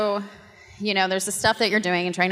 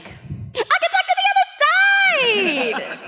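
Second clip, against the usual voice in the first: much higher, slow, laughing triumphant cry; ecstatic laughing shout; slower than usual. Retold or imitated. After the classmate confirms Lucy, shouted high and laughing. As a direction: crow it, giddy and amazed.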